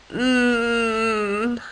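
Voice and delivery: soft blocked-nose hum; sick bird's muffled mm; stuffed-up mm. Acted. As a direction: hum through a cold. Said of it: "All Harold heard him respond".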